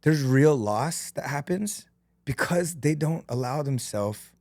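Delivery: halting, with long pauses; holding back real emotion, groping for words; getting emotional. Real, not acted. Speaker on real loss to the listeners, getting emotional. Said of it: "I don't mean to get emotional here".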